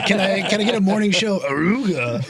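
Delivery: laughing. Real, not acted. Laughing request for sound effect.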